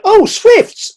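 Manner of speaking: high, loud and thrilled; wife's excited shout on spotting the swifts; excited exclamation. Retold or imitated. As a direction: call out as the swifts fly overhead. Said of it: "And Suzanne went".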